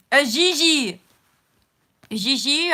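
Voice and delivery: put-on light French-accented call of "Gigi", loud and high; a hint of a French accent on "Gigi". Greeting her dog Gigi, light French accent. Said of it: "I have to speak French".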